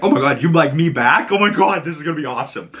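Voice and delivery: mock giddy squeal; excited Ellie. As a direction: gush like a thrilled teen who just got kissed. Ellie realizes Dina likes her.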